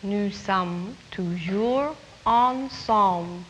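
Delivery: slow "Nous sommes toujours ensemble"; model French line. Shown as a demonstration. Teacher modelling a long line for children, slow. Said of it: "It's a long line".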